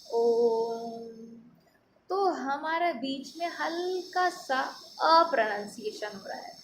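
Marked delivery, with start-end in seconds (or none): 0.1-1.1 ओम chanted long, with a held vowel; sustained om; drawn-out om. Shown as a demonstration. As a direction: draw out ओम like a chant. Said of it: "बोल के देखिएगा".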